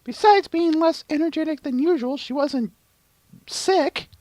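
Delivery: very high-pitched character voice; Twilight Sparkle. Acted. Answering Sunset about Luna's condition, very high voice. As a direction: play the heroine, pitched far above your own.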